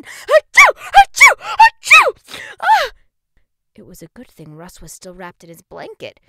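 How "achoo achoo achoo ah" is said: huge high achoo; explosive sneeze; loud sneeze. A narrator's reading. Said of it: "she sneezed again and again".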